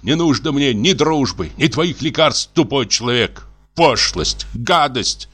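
high, raging insults; a man shaking with rage; furious shouting. A narrator's reading. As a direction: hurl the words at them. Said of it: "продолжал кричать".

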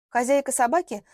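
ordinary delivery, nothing marked

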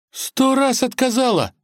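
very high-pitched, stunned query; shocked question. A narrator's reading. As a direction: ask again, utterly shaken. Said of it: "потрясённо переспросил Порфирий".

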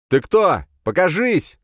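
very high, loud throat-tearing yell; straining shout. Acted. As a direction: scream it till the voice strains. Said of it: "крикнул я, надрывая голосовые связки". (крик на пределе связок)

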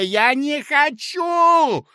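very high, loud, slow shriek; a drawn-out desperate wail; anguished cry. A narrator's reading. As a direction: refuse at the top of the voice. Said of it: "вскричал Коротков".

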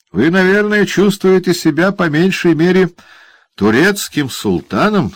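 malicious teasing dwarf; gloating dwarf. Acted. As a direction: savour the other man's discomfort. Quilp mocks Brass.

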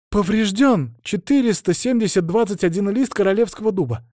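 high, earnest official report; reporting novice. A narrator's reading. Novice reports the damaged royal oak, high and earnest. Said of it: "отчиталась послушница".

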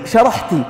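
"شرحتي" with a final i vowel after the t; feminine "shrahti". Shown as a demonstration. Teacher showing what he says to his daughter: "شرحتي" ending in i. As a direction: end "شرحتي" on a clear i. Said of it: "إذا قلت لابنتي".

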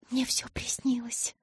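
breathy whisper, no voice at all. Acted. Bella whispering to Edward that it was all a dream. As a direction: breathe it out as if to herself.

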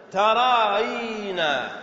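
very slow, long-held vowels; drawn-out word demo. Shown as a demonstration. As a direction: stretch the word out for students. Teacher shows the resulting form. (مدّ الكلمة ببطء شديد)

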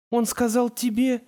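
high, startled echo of his words; incredulous question. Acted. Tamara questions the guide's claim, incredulous. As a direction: repeat it back in high-pitched disbelief. Тамара переспрашивает, не веря Уоррену.

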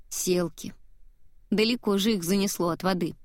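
usual speaking voice

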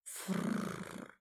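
soft voiceless rolling snort; low-volume fluttering animal breath. A narrator's reading. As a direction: snuffle like a big beast. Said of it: "издало животное".

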